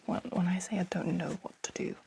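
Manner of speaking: hushed breathy whisper. Real, not acted. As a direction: drop to a whisper. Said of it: "I don't know why I'm whispering so much".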